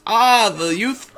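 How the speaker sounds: slow, hammed-up greeting to the young; mock delighted Hitler. Retelling Hitler meeting kids, mock delighted exclamation. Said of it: "He goes".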